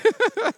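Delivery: a sudden high burst of laughter; big high laugh; loud high laugh. Real, not acted. Laughs at own joke.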